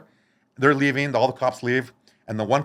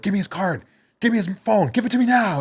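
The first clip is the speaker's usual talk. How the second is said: demanding shout; 9 semitones higher than usual. Retold or imitated. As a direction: bark the demand. Dealer demanding the phone.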